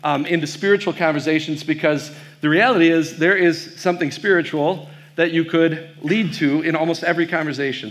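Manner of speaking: normal talking voice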